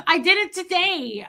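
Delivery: high mock-hysterical confession; freaking out. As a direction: wail it in mock meltdown. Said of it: "started, like, freaking out".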